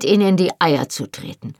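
ordinary delivery

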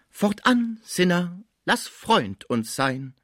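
grand stage verse, starting high and slowing; theatrical declaiming. A narrator's reading. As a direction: declaim it like a pompous tragedian. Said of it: "warf sich in die Brust und deklamierte". (pathetisch deklamiert)

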